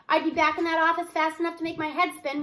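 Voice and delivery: ordinary delivery, nothing marked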